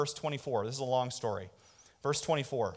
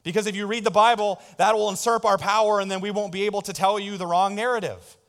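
mock priest indignation; 5 semitones higher, 6 dB louder than usual. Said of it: "stand up and say".

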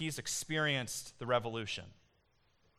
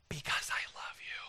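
a soft whisper, barely voiced; 5 dB quieter than usual. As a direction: breathe it like a secret to a loved one. Tender answer amid the sermon.

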